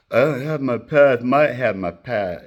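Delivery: loud, slurred nonsense mumbling; mock garbled reply, half shouted; garbled mumble. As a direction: mumble the words like you can't speak right. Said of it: "I just said some garbled bullshit".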